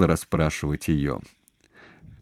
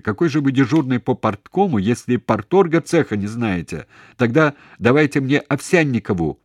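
higher, raised demanding voice; shouting; 5 semitones higher, 4 dB louder than usual. A narrator's reading. Man berates the duty officer by phone, shouting. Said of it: "и он стал кричать".

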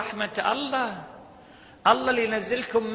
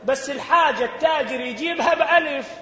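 raised, heated voice with a laugh; outraged disbelief, pitched higher; indignant; 7 semitones higher, 3 dB louder than usual. Real, not acted. Preacher to congregation about corrupt customs, heated outcry.

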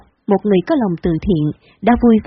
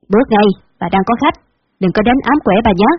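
plain reading voice

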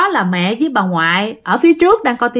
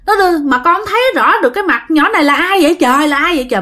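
higher, hurried frantic outcry; retold frantic yelling; 6 semitones higher, faster than usual. Dad crying out to his mother about the girl, frantic and higher. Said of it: "Ba la làng lên vậy đó".

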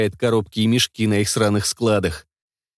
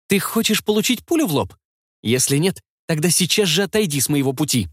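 put-on child's voice, threat through clenched teeth; through gritted teeth; 6 semitones higher than usual. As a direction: hiss a threat in a high kid's voice. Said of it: "процедил сквозь зубы".

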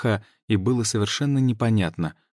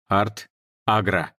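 command; slower than usual. Acted. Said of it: "скомандовал я".

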